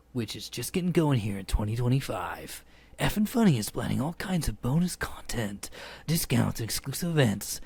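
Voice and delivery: hammed-up Australian podcast reader; Dale Kingsmill, Australian accent. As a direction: imitate an Australian woman reading ad copy. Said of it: "they really wanna hear my impersonation of Dale".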